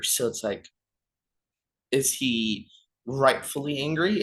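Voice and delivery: ordinary talk, nothing marked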